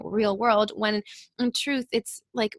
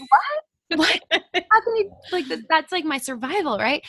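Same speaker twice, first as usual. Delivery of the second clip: retold shock; 7 semitones higher than usual. Podcast guest reliving shock at coach's advice, high laughing disbelief. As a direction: squeak out stunned protest. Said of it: "And I was like".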